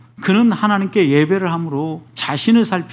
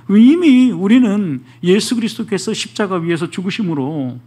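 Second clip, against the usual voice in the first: ordinary talk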